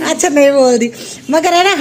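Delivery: loud and high, half laughing. Real, not acted. Mother telling viewers the elephant oil ran out, laughing. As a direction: talk on while still laughing. Still laughing over the joke.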